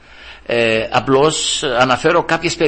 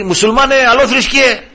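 higher, louder mock jeer at a young worker; retold boss's taunt, raised and loud; 11 semitones higher, 5 dB louder than usual. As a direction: sneer the insult up high like a scornful boss. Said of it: "έτσι με έλεγε".

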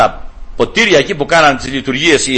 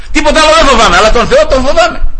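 much higher and louder, fervent; forceful declaration; 8 semitones higher, 3 dB louder than usual. Real, not acted. Preacher fervently repeating to the congregation what he told his coworker. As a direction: hammer home the conviction.

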